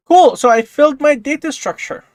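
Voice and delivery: high, loud triumphant cheer. Real, not acted. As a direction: cheer the win. Triumphant after print works.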